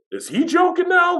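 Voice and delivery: incredulous. Retold or imitated. Incredulous retelling.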